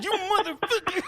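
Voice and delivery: high, loud mock outrage, breaking into laughter; bound captive cursing. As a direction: curse your captor in a hammed-up squeal. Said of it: "It's like".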